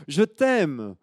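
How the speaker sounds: put-on high, loud child's call; loud high I love you; little girl calling. Girl calls out lovingly.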